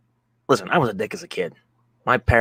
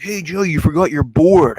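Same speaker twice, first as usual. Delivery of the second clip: dopey buddy; 5 semitones higher, 5 dB louder than usual. Retold or imitated. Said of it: "he's like".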